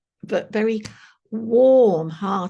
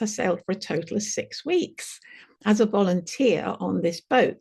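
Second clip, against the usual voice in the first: ordinary talk, nothing marked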